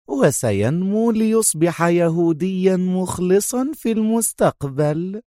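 high, mock-reassuring taunt; sarcastic. A narrator's reading. Sonia taunts Adham about their son, sarcastic. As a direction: draw it out with feigned sweetness. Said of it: "قالت بنفس السخرية".

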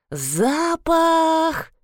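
drawn-out, high-pitched moan. A narrator's reading. Krause complains about the smell, moaning. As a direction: groan the word out long and high. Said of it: "простонала Краузе".

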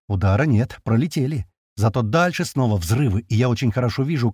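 usual speaking voice

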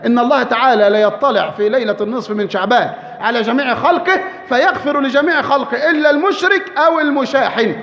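normal talking voice

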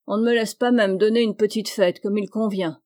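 usual speaking voice

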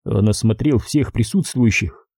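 usual speaking voice

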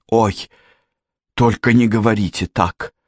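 mildly pleading lament; a distraught girl protesting, lightly wailed. A narrator's reading. Janet refusing the news of the death, lamenting. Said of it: "запричитала Джанет".

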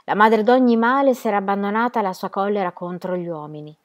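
ordinary delivery

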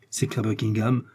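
ordinary delivery, nothing marked

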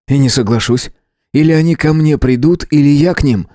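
a stubborn, jittery earthling digging in; agitated earthling. A narrator's reading.

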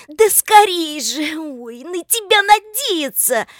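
high, loud, drawn-out exasperation; impatient outburst. A narrator's reading. Lika fed up with slow Oleg, high exasperated cry. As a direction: hurry him, then give up on him loudly.

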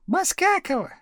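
high-pitched call across the class; shouted name. A narrator's reading. Classmates calling false names to the teacher, high shout. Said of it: "кричали Слушкину".

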